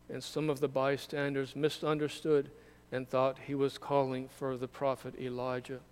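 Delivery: ordinary talk, nothing marked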